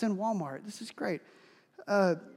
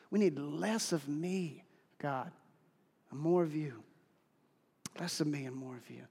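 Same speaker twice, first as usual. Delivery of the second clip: quiet prayer; 4 semitones lower, 3 dB quieter than usual. Real, not acted. Prayer to God.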